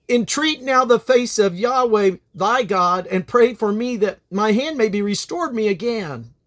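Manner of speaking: high, loud desperate plea; pleading cry. Acted. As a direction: beg for your withered hand. Stricken king begs the prophet.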